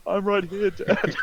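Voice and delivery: high-pitched mock protest from a slighted son; imitating Harry. Son objecting to his father's insult, high mock protest. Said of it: "Harry's like".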